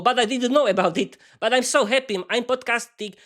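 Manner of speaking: normal talking voice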